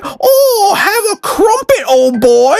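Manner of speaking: very high, hammed-up British accent; mock British. American teasing someone British, high mock British accent. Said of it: "if you mention anything about being British".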